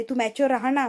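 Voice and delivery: ordinary delivery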